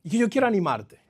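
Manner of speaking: ordinary talk, nothing marked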